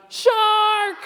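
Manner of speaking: very high, loud shout of "Shark!". Shown as a demonstration. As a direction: yell "Shark!" as loud as you can. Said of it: "Can you say that really loud?".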